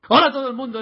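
big, bright hello to listeners; loud show greeting. Real, not acted. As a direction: announce the show opening loudly.

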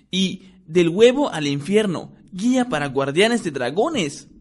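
a discovery shared with friends; excited boy. A narrator's reading. Ron voiced, excited discovery.

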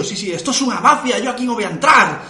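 high, loud frantic refusal; panicked shout. Retold or imitated. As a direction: yell it like you're scared stiff. (grito asustado y agudo)

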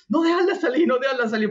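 high pitched pleading shout; urgent yelling. Retold or imitated. Edu pleading from the other room, urgent high yell. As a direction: beg them not to let it out. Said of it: "el Edu le decía".

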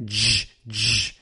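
isolated J as a buzzy dzh; short J like the end of "edge"; strong G sound. Shown as a demonstration. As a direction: sound out J twice, just the consonant. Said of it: "la G fuerte".

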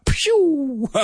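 drawn-out high imitated whoosh; whooshing sound effect. Shown as a demonstration. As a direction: fire off a high whistly zoom sound. Imitates sound of scoring big word.

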